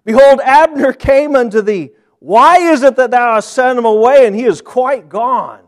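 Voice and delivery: loud, high rebuke; excited outrage. Acted. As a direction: scold the king for letting him go. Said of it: "got so excited".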